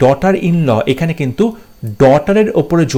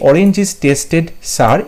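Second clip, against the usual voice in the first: each word slow and separate; slow, careful reading of an exam option; slow dictation; much slower than usual. Shown as a demonstration. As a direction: read it out word by word for the class. Announcing the right option.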